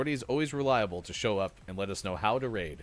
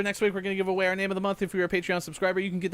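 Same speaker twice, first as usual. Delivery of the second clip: fast talking; 7 semitones higher, faster than usual. Real, not acted. Rushing after told talk faster.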